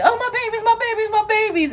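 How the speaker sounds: high, loud, thrilled cry of joy; excited gushing. Retold or imitated. Mother overjoyed at her sons' surprise, gushing loudly. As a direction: gush over the kids like a proud mom. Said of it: "So she was so excited".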